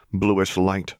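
ordinary delivery

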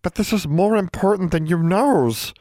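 high, pained elf voice; mildly pained, pitched high. A narrator's reading. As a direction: insist as if it hurts to say. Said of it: "She sounded pained".

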